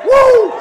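exultant woo. Real, not acted. Preacher's exultant cry.

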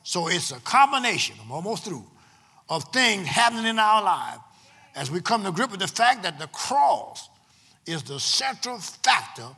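loud, emphatic preacher with long-held key words; full-force preaching. Real, not acted. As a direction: preach it to the congregation in bursts, punching the key words.

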